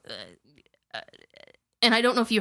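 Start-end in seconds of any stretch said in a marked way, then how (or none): 0.1-1.0 low throaty uncertain well uh; guttural hesitation. Retold or imitated. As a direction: imitate a throaty stalled answer. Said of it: "his voice started doing the".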